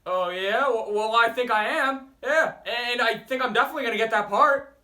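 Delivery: student yelling back that he'll get the part; defiant shouting. Real, not acted. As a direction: argue back loudly. Defiant argument with teacher.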